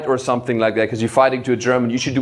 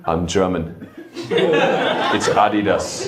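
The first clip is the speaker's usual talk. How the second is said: low, slow, deliberate German deadpan; precise German; 9 semitones lower, much slower than usual. Retold or imitated. German audience member answering a brand question, precise and deadpan. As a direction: measure out each word with cold precision. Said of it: "It was just pure precision".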